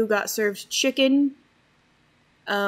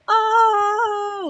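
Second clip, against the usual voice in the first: a much higher, louder mock "Oh!"; excited squid gasp; 12 semitones higher, 8 dB louder than usual. Squid bro cheering the archer's coin shot, high excited yelp. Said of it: "the two squid bros are like".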